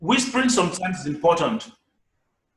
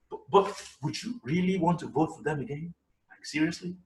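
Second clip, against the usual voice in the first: much softer, confiding; whisper; 6 dB quieter than usual. Shown as a demonstration. Teacher to class, soft confiding question. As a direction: lean in and hush it. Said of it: "You might whisper it".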